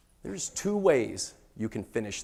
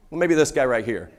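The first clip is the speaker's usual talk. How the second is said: ordinary talk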